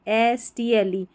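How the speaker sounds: usual speaking voice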